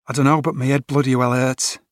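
lightly voiced Liverpool mate nursing his head; Scouse accent. A narrator's reading. Tom tells Carter his head hurts, Scouse accent. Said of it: "Tom's Scouse accent".

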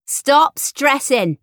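high, loud, drawn-out daughterly reproach; stress-inducing voice. A narrator's reading. Daughter telling stressed mum to stop, in her maddening drawl. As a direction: stretch it out slowly, loud and high. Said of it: "using the voice that makes me stressed".

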